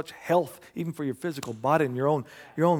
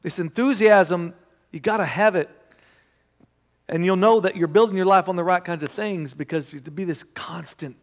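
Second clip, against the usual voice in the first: high, loud, driving sermon voice; fiery preaching; 4 semitones higher, 5 dB louder than usual. Acted. Pastor stirring his congregation about Paul's joy, full-force preaching. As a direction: preach it hard to the room.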